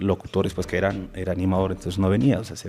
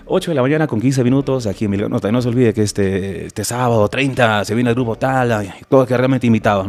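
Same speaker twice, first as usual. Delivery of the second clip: louder, punchy radio announcement; radio announcer voice; 2 semitones higher, 6 dB louder than usual. Retold or imitated. Young DJ's first on-air announcement, louder announcer voice. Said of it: "lo hice así temblando, pero con otro énfasis un poco más fuerte".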